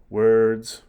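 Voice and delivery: loud word. Acted. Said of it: "Hamlet answering Polonius".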